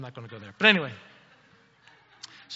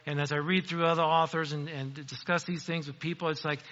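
normal talking voice

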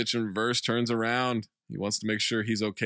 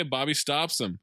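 ordinary talk, nothing marked

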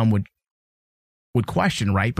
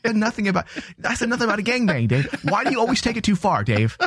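much higher, fake-offended squeal; mock indignant; 8 semitones higher than usual. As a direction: protest in a squeaky hurt voice.

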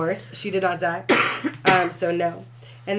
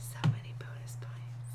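whisper; 13 dB quieter than usual. Real, not acted. Host teasing aside, whispered.

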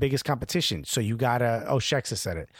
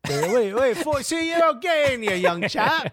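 mock bouncer; 9 semitones higher, 3 dB louder than usual. Mock British bouncer refusing entry.

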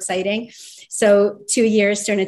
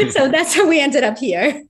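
laughing; 6 semitones higher, 5 dB louder than usual. Real, not acted. Laughs right before and after.

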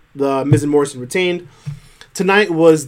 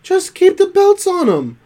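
exasperated. Real, not acted. Exasperated rant about title booking.

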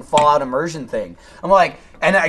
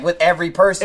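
ordinary talk, nothing marked

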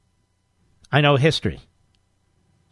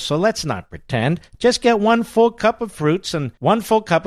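upbeat host-read ad; 5 semitones higher than usual. Acted. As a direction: sell it like a radio ad. Host reads sponsor ad.

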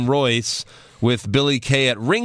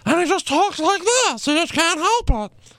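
very high squeaky falsetto; High Pitch Eric. Retold or imitated. Telling co-host about a radio show regular, high squeaky imitation. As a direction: squeak it in a piercingly high mimicking voice. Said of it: "there's High Pitch Eric".

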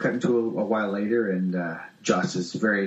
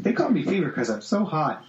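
lightly voiced show-off, pitched higher; a hint of a giggly flirt; flirty introduction; 6 semitones higher than usual. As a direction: lift the voice into a playful boast. Acts out Shailene's flirty introduction.

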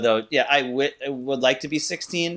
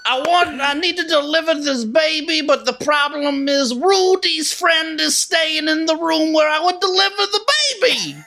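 Bill Cosby impression; 14 semitones higher than usual. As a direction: ramble like Cosby. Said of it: "Can you guys do a Bill Cosby impression?".

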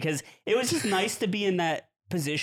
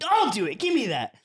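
put-on higher, louder eager kid voice; bloodthirsty little brother; 6 semitones higher, 4 dB louder than usual. Little brother demanding the gun from his sibling. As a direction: grab for it, eager and pushy. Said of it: "brother was just bloodthirsty".

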